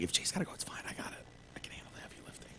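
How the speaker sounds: a retold offer fading down to a whisper; quoting the friend in a hushed near-whisper; mock hush.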